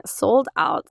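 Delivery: usual speaking voice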